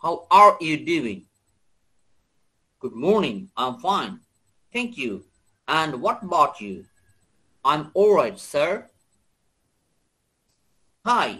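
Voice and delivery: slow, deliberate American English; American accent. Shown as a demonstration. Teacher reads a dialogue for students, American accent. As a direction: read it with American r's. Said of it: "এখন American অ্যাকসেন্টে পড়বো".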